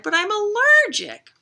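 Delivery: high, loud dramatic reveal; hammed-up storybook girl; girl's playful lament. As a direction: wail it lightly as the story's girl. Girl confesses allergy, played up.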